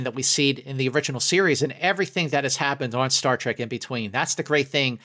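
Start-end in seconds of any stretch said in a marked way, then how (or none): none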